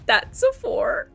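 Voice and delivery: ordinary talk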